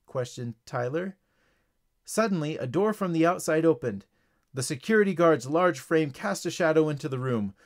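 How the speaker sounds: ordinary delivery, nothing marked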